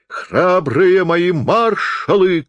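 high, drawn-out commander's cry; Napoleon. A narrator's reading. Stage Napoleon summons his marshals with a long theatrical cry. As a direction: proclaim it grandly, stretching each word. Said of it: "крикнул Наполеон".

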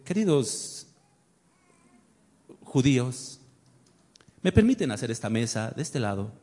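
sarcastically over-polite request; mock polite Christ. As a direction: ironic gentle voice asking permission. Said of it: "Fue cortés y les dijo".

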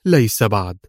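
understated sharp reply; a touch of defiant edge; sharp retort. A narrator's reading. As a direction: cut back at the taunt. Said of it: "قالت في حدة".

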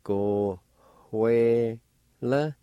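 "Go, hue, le" slowly, separated, stepping up in pitch; syllable-by-syllable pronunciation. Shown as a demonstration. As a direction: sound out "Go, hue, le" with a faint H before the W. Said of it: "Like this".

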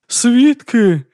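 loud, high-pitched thundering cry. A narrator's reading. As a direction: thunder it to the crowd. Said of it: "гриміла вона".

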